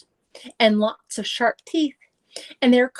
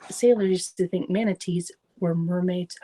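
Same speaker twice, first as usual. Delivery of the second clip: ordinary delivery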